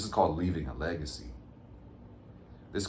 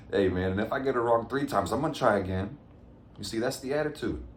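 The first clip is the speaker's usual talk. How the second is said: upbeat; 6 semitones higher, 3 dB louder than usual. Real, not acted. Laughing off mistake, upbeat.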